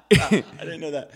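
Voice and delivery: giggling while answering; amused laughing reply. Real, not acted. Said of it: "he does it with every song".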